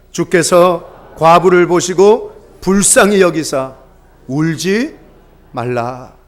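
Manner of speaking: slow, solemn reading of the verse; slow scripture reading. Shown as a demonstration. Pastor leads the congregation reading scripture, slow and solemn. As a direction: read the scripture slowly, letting it weigh. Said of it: "한번 읽어볼까요? 시작".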